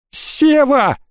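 almost shriek the name in panic; near squeal. A narrator's reading. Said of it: "Ржавый чуть ли не взвизгнул". (почти взвизгнув)

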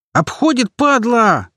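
high-pitched alarmed yell. A narrator's reading. Fighter spots the monster flanking, yelling. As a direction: yell the warning at the top of your range. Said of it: "заорал боец".

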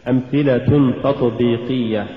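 ordinary talk

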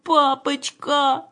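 high, long drawn-out whine; pleading moan.

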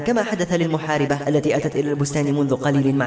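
ordinary delivery, nothing marked

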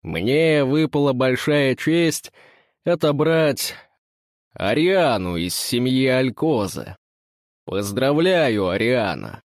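put-on slow, slurred drunken announcement; lightly voiced tipsy master; drunken pompous speech. A narrator's reading. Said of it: "заводит пьяную пафосную речь".

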